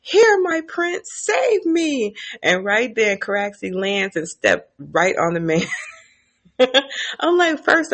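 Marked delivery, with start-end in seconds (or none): 0.0-2.1 high put-on plea; mock pleading soldier. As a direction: beg the prince in a high squeak. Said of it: "you can hear him say".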